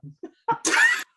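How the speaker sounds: high, loud shrieking burst of laughter. Real, not acted. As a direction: cackle out loud at the punchline. Big laugh at own story.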